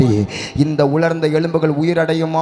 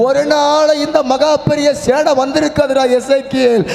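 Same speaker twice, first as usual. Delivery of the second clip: climbing louder and higher to the close; building preaching; 7 semitones higher, 5 dB louder than usual. Acted. Pastor assuring the grieving congregation, rising preaching. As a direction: lift each line toward the promise.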